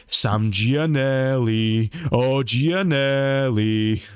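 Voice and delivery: drawn-out taunting of a name; mocking bullies. Bullies teasing a schoolmate over his name, mocking. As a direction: stretch the name out very slowly to mock. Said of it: "the bullies of the school would be like".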